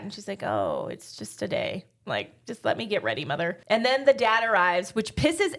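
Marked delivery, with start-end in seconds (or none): 0.4-3.6 low, mumbled brush-off, much lower and softer; sulky and deflated, waving her mother off; mopey. Retold or imitated. Recapping Clara brushing off her mother's questions, mopey and low. Daughter is mopey before gala.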